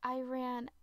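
ordinary delivery

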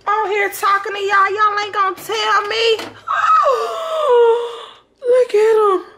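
very high, loud wail; wailing lament. Real, not acted. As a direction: cry out in dismay at the ruined food. Bagels burnt while she filmed.

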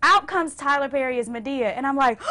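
usual speaking voice

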